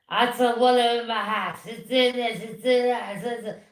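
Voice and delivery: imitating a drunk mother's slur, loud; slurred drunk mimicry; mock drunk slurring rant; drunken slur. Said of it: "all slurring".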